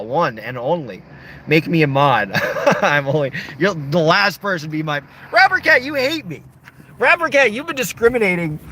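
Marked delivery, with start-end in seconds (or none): 5.3-8.6 high, whiny mock complaint; playful high whine at Rapper Cat. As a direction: whine up high like a wounded kid.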